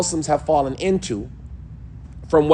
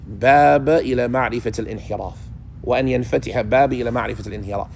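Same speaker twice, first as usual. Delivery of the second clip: rapid Arabic text reading; 4 semitones lower, much faster than usual. Acted. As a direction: rattle off the classical Arabic quickly, half under the breath. Reads the Arabic text aloud.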